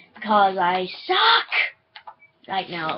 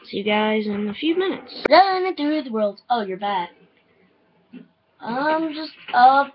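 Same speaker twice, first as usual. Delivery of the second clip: ordinary delivery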